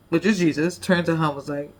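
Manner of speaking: usual speaking voice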